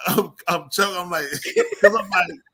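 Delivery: talking through laughter; choking on laughs; laughing. Real, not acted. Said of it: "So I'm like laughing so hard".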